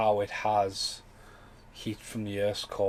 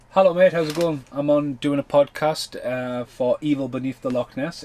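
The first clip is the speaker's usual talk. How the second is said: much louder, higher cheery mate greeting; reading friend's voicemail; 4 semitones higher, 8 dB louder than usual. Retold or imitated. Paul Ray's voicemail read aloud to viewers, loud cheery greeting. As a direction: belt it out like a cheerful mate on the phone. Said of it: "I had a message".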